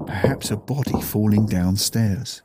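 ordinary delivery, nothing marked

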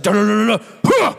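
loud grunting strum sounds; mock strumming noises. Imitating a pastor strumming guitar hard.

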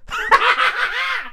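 high burst of laughter. Real, not acted. Joking about African mothers, bursting laugh. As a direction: crack up at the punchline.

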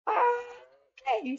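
drawn-out, very high imitated little okay; high-pitched okay. Girl alone in the hallway, acting out a high uncertain okay. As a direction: sing it up high, as if bracing yourself. Lost child composing herself in hallway.

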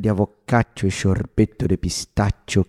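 put-on Portuguese with a thick Italian accent. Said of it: "Adorei seu sotaque italiano".

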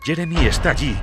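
ordinary delivery, nothing marked

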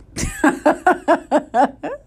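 high burst of laughter; staccato cackling laugh. Real, not acted. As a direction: crack up at your own story. Laughs while retelling story.

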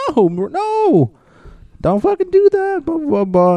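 high, pleading Morty whine; imitating Morty. Cohost imitating Morty stopping Jerry, high and pleading. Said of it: "Morty's like".

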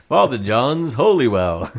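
slow, lilting mock ad tagline; infomercial pitch. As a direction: sell it like a cheesy infomercial jingle. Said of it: "sounds like an American infomercial".

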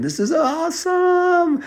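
very high, slow thrilled shout; joyful scream while flying. Acted. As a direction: whoop it out in delight. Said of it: "We're screaming".

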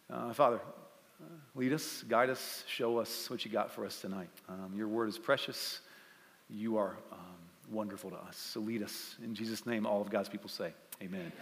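soft, low prayer voice; hushed prayer to the Father; quiet prayer. Real, not acted. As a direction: pray gently over the room. Leads a closing prayer.

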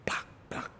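voiceless slap noises; whispered slap sounds. Retold or imitated. Showing how she slapped him, voiceless slap noises. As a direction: breathe out two slap sounds. Imitates the slaps.

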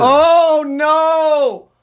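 high, loud horrified cry; shocked shout. Real, not acted. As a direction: shriek in disbelief. Hearing of year-long hiccups.

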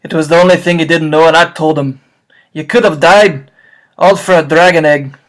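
loud, booming half-giant voice; loud rough gamekeeper voice; Hagrid voice. Acted. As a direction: boom it out like a big-hearted giant in tears. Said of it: "can you do this paragraph in Hagrid voice".

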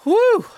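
loud high exhale of relief, catching breath; breathless whew. Real, not acted. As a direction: blow out a big high whew like after a run. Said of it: "I'm out of breath over here".

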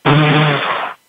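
sudden caught breath of relief; sharp gasp. Retold or imitated. Retelling being understood by the greeter, one sharp gasp. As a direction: gasp as if seen through. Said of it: "I just went".

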